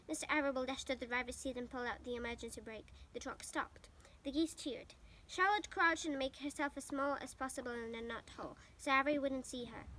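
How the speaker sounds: plain reading voice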